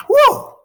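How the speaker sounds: high, loud whew; amazed whew. Real, not acted. Teacher marvelling at Fitzgerald's many cut commas, loud amazed whew. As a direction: let out a loud, high-pitched whoop of amazement.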